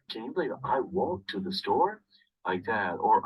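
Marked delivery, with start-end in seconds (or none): 0.1-2.0 higher-pitched, incredulous question; animated storytelling with a rising finish; animated example. Shown as a demonstration. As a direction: act out lively disbelief. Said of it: "move your body as you talk".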